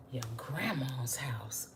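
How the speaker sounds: soft awed whisper. Real, not acted. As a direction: whisper it like a cozy secret. Savouring the biscuit smell.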